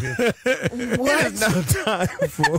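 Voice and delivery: segment announcer. Pretend. Mock news segment intro.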